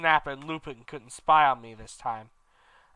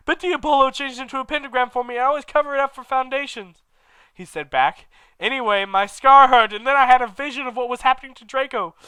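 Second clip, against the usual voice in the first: higher, louder and fast breathless rant; Vampire's voice; 10 semitones higher, 8 dB louder than usual. Acted. Vampire explaining his scar in his high rushed voice. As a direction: gabble it out at a higher pitch.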